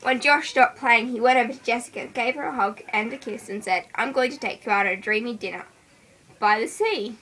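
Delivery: ordinary delivery, nothing marked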